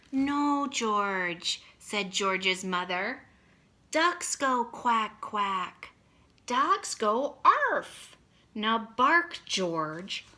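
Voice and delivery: slow, lively picture-book reading; reading to children. A narrator's reading. Teacher reading a picture book to her class, slow and lively. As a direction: read it out big for kindergartners.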